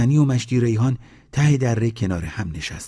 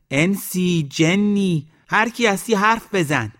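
very high, drawn-out calling; shouting; 11 semitones higher, 3 dB louder, slower than usual. A narrator's reading. Old woman challenges whoever hides in the valley, shouting. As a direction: call out a challenge to unseen spirits. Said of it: "ننه خانوم داد زد".

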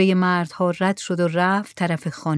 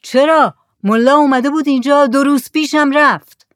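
loud, high-pitched holler; shouted reply; 6 semitones higher, 7 dB louder than usual. A narrator's reading. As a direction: shout back to those on the boat. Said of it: "زکریا داد زد". (با فریاد جواب دادن)

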